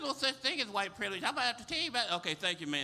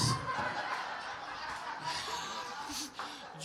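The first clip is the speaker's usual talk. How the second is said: laughing; 7 semitones higher, 3 dB quieter than usual. Real, not acted. Laughs after punchline.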